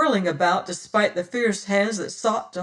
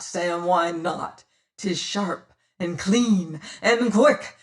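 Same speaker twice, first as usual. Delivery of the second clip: taunting pirate captain; 2 semitones higher, 6 dB louder than usual. Acted. Pirate captain taunting the terrified boatswain, cruel and mocking. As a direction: savour the threat.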